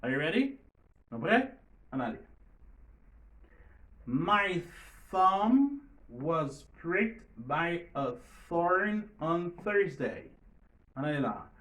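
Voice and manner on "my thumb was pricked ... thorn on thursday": very slow, loud model of "thumb", "thorn", "Thursday"; th practice. Shown as a demonstration. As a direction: read word by word, higher, each th in "thumb" and "thorn" exact. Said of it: "pratiquons différents sons que T H". (li dousman, chak th nan "thumb", "thorn", "Thursday" byen klè)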